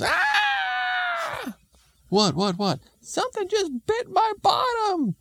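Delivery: very high comic shriek; hammed-up lady's scream. Lady bitten while bending over, high mock scream. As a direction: shriek in falsetto as if suddenly bitten.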